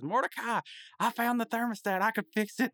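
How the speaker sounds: excited high-pitched cartoon call; high mock-excited shout; Rigby. Rigby excitedly calls out his find.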